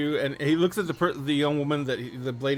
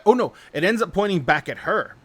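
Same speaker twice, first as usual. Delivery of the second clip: usual speaking voice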